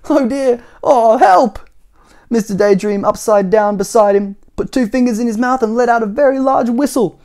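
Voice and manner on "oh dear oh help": put-on high, loud frightened boy; Jack's gasp. Jack gasps at crocodile teeth.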